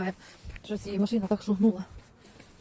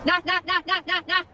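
calling dogs; 9 semitones higher, 3 dB louder, slower than usual. Real, not acted.

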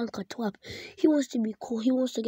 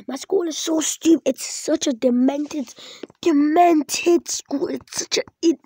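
loud, higher, dragging out demented; angry rant; 5 semitones higher, 7 dB louder than usual. Real, not acted. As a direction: let the outrage spill over at the school. Ranting about being punished.

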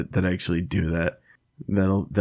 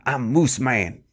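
lightly voiced Moose Man; higher, announcing himself as a half-man half-moose; a touch of a daft Moose Man voice; 6 semitones higher than usual. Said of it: "he's like".

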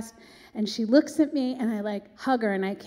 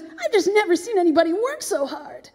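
big, high-pitched star impression; 8 semitones higher, 6 dB louder than usual. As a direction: gush it loudly like a stage diva. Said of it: "She goes".

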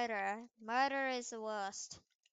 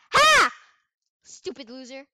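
mocking taunt; 16 dB louder than usual. Real, not acted. Mocking laugh.

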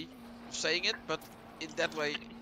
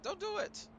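high mock plea; 9 dB quieter than usual. Real, not acted. Protesting the fix.

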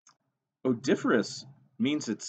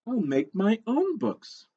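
high, slow storybook declaration; acted resolve, pitched way up; determined hen; 12 semitones higher, 3 dB louder, slower than usual. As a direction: proclaim it as a plucky little hen. Hen vows after rejection.

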